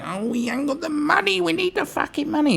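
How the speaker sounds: sarcastic high beggar voice for police funding; mock whine. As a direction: squeal the demand like a spoilt official. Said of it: "they kept going".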